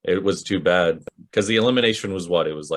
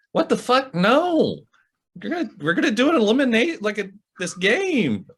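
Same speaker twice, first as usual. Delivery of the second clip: exasperated TJ. Retold or imitated. TJ voiced reacting in retold scene.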